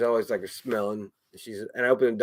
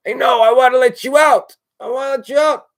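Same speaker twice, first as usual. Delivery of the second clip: much higher, loud plea; exasperated shout; 12 semitones higher, 11 dB louder than usual. Acted. Owner answering the cat who wants out, exasperated shout. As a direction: yell back, pitched way up. Acted argument with his cat.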